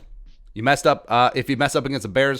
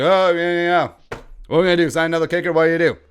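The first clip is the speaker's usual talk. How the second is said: higher, louder dismissive shrug of a voice; mock Ted Thompson; 4 semitones higher, 4 dB louder than usual. Imitating Packers GM shrugging off kicker trouble, mocking. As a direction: play the careless GM, brushing it off. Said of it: "Ted Thompson's like".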